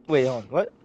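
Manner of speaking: ordinary delivery, nothing marked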